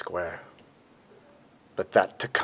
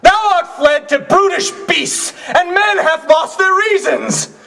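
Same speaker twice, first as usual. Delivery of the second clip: high, loud declamation to a crowd; ringing stage shout, pitched high; impassioned oration; 12 dB louder, slower than usual. Acted. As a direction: cry it out to the crowd in grief and outrage. Actor performing Shakespearean speech on set.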